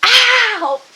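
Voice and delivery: sharp yelp of pain, high and loud; stubbed toe. Real, not acted. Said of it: "I just stubbed my toe".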